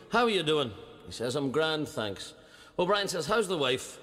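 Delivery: usual speaking voice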